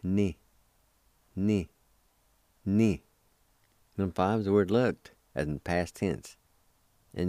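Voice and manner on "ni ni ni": "Ni" said three times, each on its own; evenly spaced repeats of "Ni"; repeated pronunciation demonstration. Shown as a demonstration. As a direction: model "Ni" distinctly with a pause after each. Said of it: "pronounced like this".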